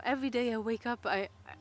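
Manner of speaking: normal talking voice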